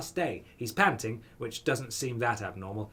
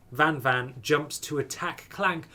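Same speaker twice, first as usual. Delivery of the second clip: ordinary talk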